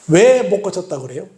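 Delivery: ordinary delivery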